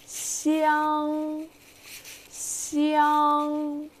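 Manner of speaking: 香 held high and level; 香 flat and high; 香 with a steady high pitch; high level first tone. Shown as a demonstration. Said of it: "the first tone is high and straight".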